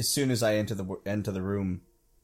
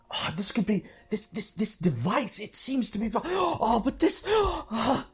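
rising ever higher, slowing into awed gasps; frantic excitement; 12 semitones higher, slower than usual. Acted. Scholar fawning over a strange device, frantic and high. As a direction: lose yourself in frantic wonder. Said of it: "I'm just sort of frantic with".

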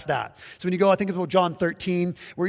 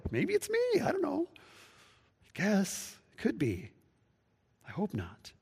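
unsure disciple; 4 semitones higher, 8 dB quieter than usual. Retold or imitated. Disciple unsure, voice high then fading.

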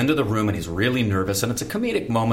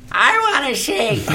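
put-on high, loud, slow old lady voice; old lady in audience; 5 dB louder, slower than usual. Actor imitates the lady in the front row, high and loud. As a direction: squawk it out like a deaf old matinee lady. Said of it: "this lady in the silence saying".

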